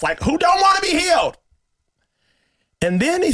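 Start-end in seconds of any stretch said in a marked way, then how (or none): 0.0-1.4 mock shout. Retorting to the pastor's question.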